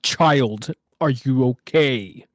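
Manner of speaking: mock-solemn interrogator. Store worker to a scared child, mock-solemn booming voice. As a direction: intone it like a grand ominous being.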